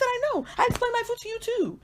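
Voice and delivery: very high-pitched acted exasperation; high, incredulous agreement with a friend; exasperated agreement. Acted exasperated reply to roommate.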